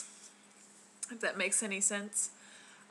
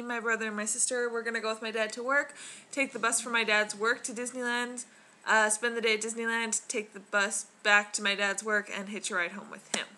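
ordinary talk

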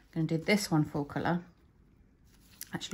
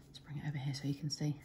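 soft unvoiced whisper; 9 dB quieter than usual. Real, not acted. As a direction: whisper it close to the mic. Shows colour close to camera.

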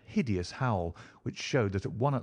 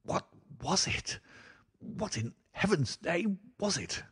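high, frightened, barely voiced; terrified whisper; 10 semitones higher than usual. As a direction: gasp the question out in terror. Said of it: "he whispered".

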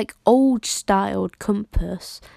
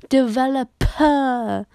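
slow, louder, laughing "Developer"; laughing through the word; 5 semitones higher, 5 dB louder, slower than usual. Real, not acted. Finally saying "Developer" right, laughing and slow. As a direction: drag out "Developer" while laughing. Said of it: "I keep saying developer".

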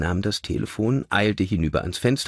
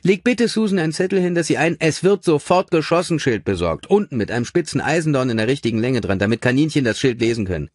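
rattled-off, higher-pitched dictation; brisk boss dictating; 7 semitones higher, 5 dB louder, faster than usual. A narrator's reading. Gordon dictating orders onto an answering machine, brisk and rapid. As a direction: fire off instructions at a fast clip.

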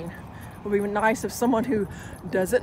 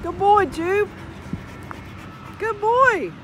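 excited dog praise; 10 semitones higher, much slower than usual. Real, not acted. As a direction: cheer the dog on as he fetches.

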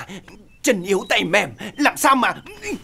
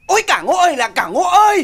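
loud, high wail of a wife in labour; imitated wife's cursing; 5 semitones higher, 8 dB louder than usual. Husband quotes his wife cursing him in labour, loud mock wailing. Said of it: "À, nó chửi là".

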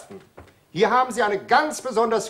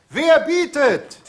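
ordinary talk, nothing marked

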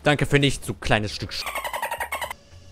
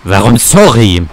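loud, annoyed repeat; indignant shout; 3 semitones lower, 12 dB louder than usual. Real, not acted. Gamer yelling at teammate who typed sorry, loud and indignant. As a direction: bark the question back at him.

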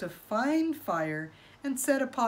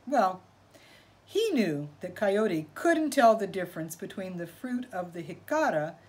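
ordinary delivery

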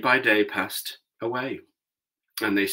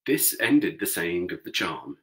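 ordinary delivery, nothing marked

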